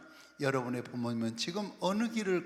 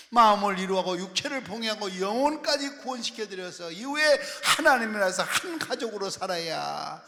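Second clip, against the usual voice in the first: raised, forceful sermon delivery with loud bursts; impassioned preaching; 7 semitones higher, 13 dB louder than usual. Real, not acted. Preacher urging the congregation to save their parents' souls, impassioned preaching. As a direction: drive the questions home, then declare it loudly.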